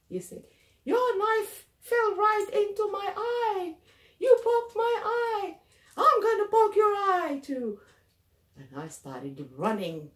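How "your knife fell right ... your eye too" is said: high, loud, outraged shouting; furious high-pitched yelling; screaming threat. Acted. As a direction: scream accusations like an injured man out for revenge.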